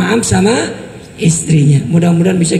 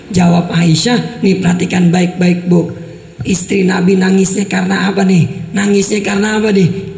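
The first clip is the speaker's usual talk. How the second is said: ordinary talk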